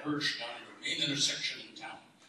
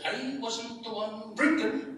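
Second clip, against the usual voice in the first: sly punchline; 11 semitones higher, 5 dB louder, slower than usual. Acted.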